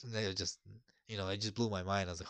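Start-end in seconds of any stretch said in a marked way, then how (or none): none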